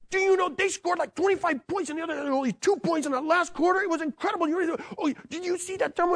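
high, rushing, breathless excitement; excited fan. Retold or imitated. Husband gushing to his wife about the game, excited and high. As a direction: gush about the game, unable to stop. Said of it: "I like sports and I'm excited".

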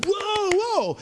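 high, excited stadium cheer; excited whoa. Retold or imitated. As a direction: cheer the hit from the stands. Cheering a hit at ballgame.

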